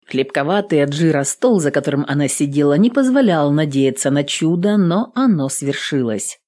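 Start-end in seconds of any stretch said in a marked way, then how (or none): none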